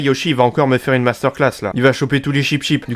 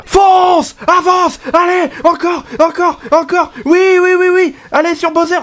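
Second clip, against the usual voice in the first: frantic, very high-pitched shouting; gueuler comme un dingue; 14 semitones higher, 3 dB louder, slower than usual. Real, not acted. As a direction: yell short urgent commands at the game, like a madman. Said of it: "suffit juste de gueuler comme un dingue". (hurler comme un dingue, très aigu)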